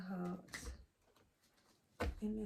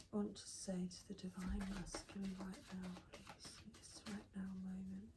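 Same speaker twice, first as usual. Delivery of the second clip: hushed unvoiced whisper, softer and softer; 9 dB quieter than usual. Real, not acted. Reader whispering her question to spirit. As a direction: whisper it as a private plea.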